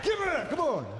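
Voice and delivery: high, strained comic shout; hammed-up, high-pitched command; mock handler's yell. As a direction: holler like you're hauling back a dog. Handler tugging an aggressive kid back.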